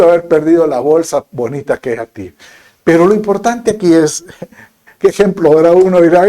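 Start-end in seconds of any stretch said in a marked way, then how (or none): none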